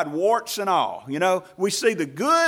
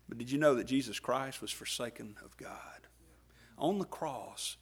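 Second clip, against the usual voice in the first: a secret dropped in a whisper; unvoiced, intimate whisper; hushed whisper; 3 semitones lower, 9 dB quieter than usual. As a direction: whisper it close to the congregation. Hushed question to the congregation.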